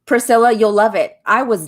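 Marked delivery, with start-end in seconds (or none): none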